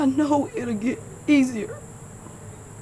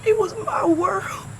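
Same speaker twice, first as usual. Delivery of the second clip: crying; 5 semitones higher than usual. Real, not acted. Grieving daughter's tribute.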